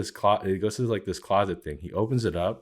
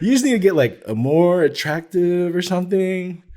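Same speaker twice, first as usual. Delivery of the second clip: much higher and louder, slowed, hammed-up boss; mocking boss voice; 12 semitones higher, 8 dB louder than usual. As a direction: exaggerate the boss's blunt advice, mocking him.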